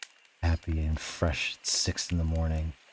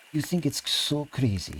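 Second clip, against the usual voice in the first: high-pitched, indignant comeback; defensive retort; 9 semitones higher than usual. A narrator's reading. Kolya defending his plan to Lev, high defensive retort. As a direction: throw it back, pitched well up.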